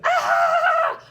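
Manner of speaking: high, loud scream mid-air. Retold or imitated. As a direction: yelp as you fly off the jump.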